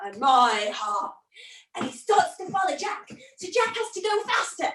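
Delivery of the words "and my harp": slow, low, drawn-out giant roar; angry giant. Acted. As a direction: giant bellows after the thief, deep and slow. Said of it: "you could hear an angry".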